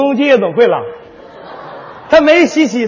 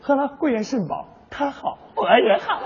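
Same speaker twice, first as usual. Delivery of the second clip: much softer, cozy commercial tone; 8 dB quieter than usual. Pretend. Partner murmurs a soft, cozy tonic commercial. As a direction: murmur it like the famous ad. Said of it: "你这广告插的也太多点儿了".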